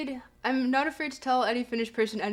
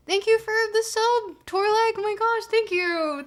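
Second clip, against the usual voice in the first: high, loud, gushing thank you; excited thanks; 9 semitones higher, 4 dB louder than usual. Real, not acted. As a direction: bubble over with delight. Thanking a new subscriber.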